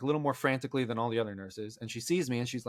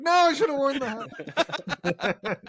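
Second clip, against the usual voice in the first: much higher, slow, laughing mock regret; 13 semitones higher, 4 dB louder, much slower than usual. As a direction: stretch it out, laughing at your own joke. Joke about one hair falling.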